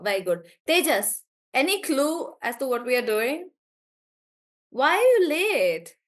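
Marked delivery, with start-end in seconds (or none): 4.7-6.0 higher, louder, slow mock-stern why are you late; mock stern question. Real, not acted. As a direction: tease the late student with a stern tone. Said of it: "teacher scolding late student".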